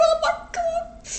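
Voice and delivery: high, startled "oh my God" in a put-on voice; Greg's shocked high-pitched reaction; shocked gasp. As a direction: squeal it like the news just hit. Greg's stunned reaction, acted out.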